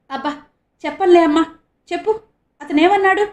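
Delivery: high, loud, pressing questions; anxious. A narrator's reading. Father anxiously presses the girl for news. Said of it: "ఆందోళనగా అన్నాడు".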